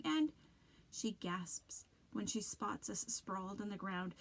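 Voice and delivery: ordinary delivery, nothing marked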